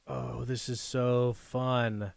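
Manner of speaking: usual speaking voice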